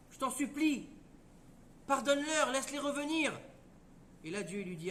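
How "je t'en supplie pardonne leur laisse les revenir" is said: pleading. Acted. Abraham pleads with God.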